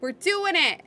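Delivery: loud happy chant; cheerful triumphant; excited. Real, not acted. Celebrating goal.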